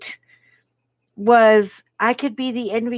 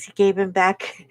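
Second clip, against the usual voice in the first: ordinary talk, nothing marked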